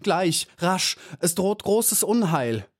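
high-pitched, pleading alarm; urgent moaned plea. A narrator's reading. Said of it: "stöhnte die kleine Maya". (flehend, hoch und dringlich)

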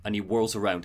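ordinary talk, nothing marked

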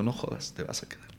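breathy whispered disbelief, voice gone. Retold or imitated. Actor whispers back to his French coach in disbelief. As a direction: whisper it like you can't believe the news.

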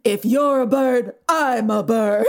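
slow, hammed-up gravitas; mock dignified Clooney. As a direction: deliver the romantic line with mock dignity. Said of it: "So much more dignified about it".

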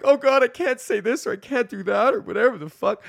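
hammed-up nervous wreck; panicky young self. Younger comedian fretting over the cop gig, high mock panic. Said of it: "would have been freaking the fuck out".

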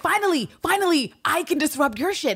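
high-pitched mock triumph; gleeful relief. Constantine finally able to disrupt Villanelle, high gleeful crowing. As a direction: crow it like payback at last. Retold Constantine relishing his turn to needle.

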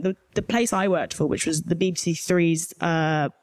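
normal talking voice

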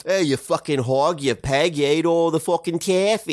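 mock Pennsylvania regional accent. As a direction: put on a caricature Pennsylvania twang. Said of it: "that's how they talk".

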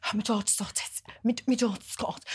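whispered, secretive gossip; mock whisper. Mum whispering to the restaurant about her daughter, secretive whisper. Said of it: "she was like this".

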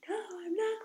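put-on high whiny kid voice; high mock kid refusal; child refusing. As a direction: pout it like a defiant toddler. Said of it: "this child was just like".

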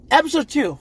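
high, loud announcement; announcer. Real, not acted. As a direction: announce it like a show host. Announcing next episode.

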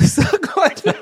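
high laugh through the words. Real, not acted. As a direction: laugh it off, embarrassed. Forgot thought mid-sentence, laughs.

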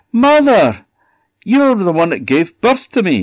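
high, loud, delighted fart voice; overjoyed to see his mother; delighted fart creature. Acted.

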